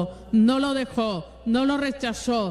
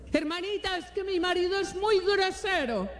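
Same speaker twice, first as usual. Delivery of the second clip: pitched-up, softer whine of a put-upon daughter-in-law; whiny complaining sister; 8 semitones higher, 3 dB quieter than usual. Retold or imitated. Preacher imitating a congregant complaining about her mother-in-law, higher, soft whine.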